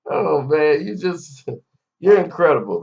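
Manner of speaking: ordinary delivery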